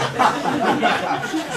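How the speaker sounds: a high airless burst of laughter; high breathy laugh. Real, not acted. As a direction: let a high laugh escape, almost silent. Laughs at the terrible plan.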